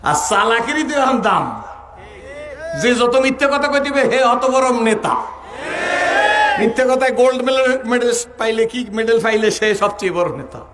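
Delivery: high, loud preaching outcry; full-force preacher scorn; rising sermon shout. Real, not acted. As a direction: shout it at the crowd like a fiery preacher. Preacher rails at lying leaders.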